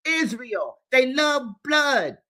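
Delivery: a furious cry against the killers; angry shout. Real, not acted. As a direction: spit the words out in outrage. Denouncing with a shout.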